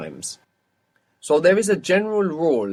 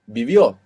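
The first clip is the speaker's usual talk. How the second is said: "Vivió" louder, stressed on the final Ó; 7 dB louder than usual. Shown as a demonstration. Teacher testing student on accents, loud final O in "Vivió". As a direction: punch the last syllable of "Vivió". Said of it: "At the O".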